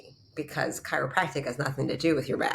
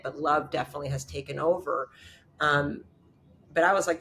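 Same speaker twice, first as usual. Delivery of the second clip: ordinary talk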